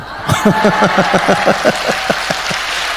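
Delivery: soft unvoiced chuckle; breathy laugh. Real, not acted. Preacher laughing at his own story, soft airy chuckle. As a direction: let out a quiet airy laugh.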